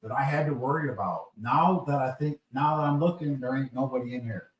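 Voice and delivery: loud, heated rant at trolls; angry rant. Real, not acted. Co-host rants at trolls in chat, loud and angry. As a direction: yell them out of the room.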